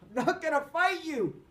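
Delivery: loud high refusal; shouting. A narrator's reading. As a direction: shout back firmly. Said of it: "Cole shouted".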